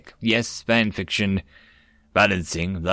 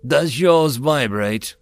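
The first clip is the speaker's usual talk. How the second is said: slow, measured "Does yours vibrate?"; deadpan slow question; 3 semitones higher, 3 dB louder, much slower than usual. Acted. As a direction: ask it flatly and slowly. Deadpan question, then repeated.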